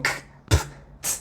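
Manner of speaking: bare consonants K, P, T, no vowel; quiet isolated pops of K, P, T; consonantes sin vocal. Shown as a demonstration. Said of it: "no tendría como sentido". (k, p, t sueltas, sin vocal)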